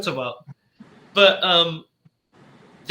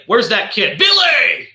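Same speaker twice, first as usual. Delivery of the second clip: imitating a father yelling for his kid; hammed-up bellow building on the name; mock angry shout; 6 semitones higher, 6 dB louder, slower than usual. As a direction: holler the name, high and loud. Retold angry father calling kid.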